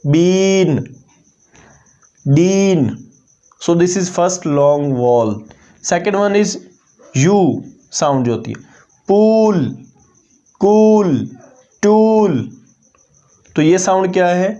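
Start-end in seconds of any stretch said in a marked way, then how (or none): 0.0-2.9 बीन and डीन with a drawn-out long ee; long ee vowel demo. Shown as a demonstration. Teacher showing students the long ee, drawn out. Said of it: "long आई sound आ रही है". 9.1-12.4 पूल, कूल, टूल with a stretched long oo; long oo vowel demo. Shown as a demonstration. Teacher showing the long oo to students, drawn out. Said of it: "यू की long sound है".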